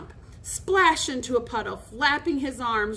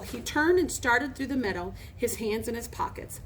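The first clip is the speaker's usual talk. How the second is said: plain reading voice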